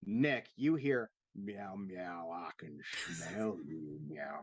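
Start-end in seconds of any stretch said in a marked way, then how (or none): 1.4-4.4 low, soft whispered cat voice; creepy cat whisper. Acted. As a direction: hiss it like a menacing cat. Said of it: "the cat just growl real low and dangerous".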